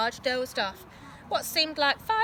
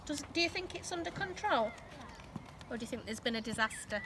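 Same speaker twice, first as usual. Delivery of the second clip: ordinary delivery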